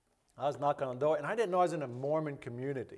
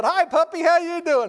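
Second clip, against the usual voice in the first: high, loud greeting to a puppy; over-friendly, high-pitched hello; cheery dog greeting; 13 semitones higher, 10 dB louder than usual. Retold or imitated.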